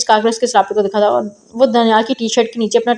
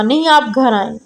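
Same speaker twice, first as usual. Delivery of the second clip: ordinary delivery, nothing marked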